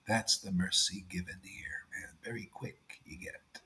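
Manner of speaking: hushed, unvoiced whisper; preacher whispering an aside about mercy. Real, not acted. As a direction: lean in and whisper the secret. Aside to the listeners.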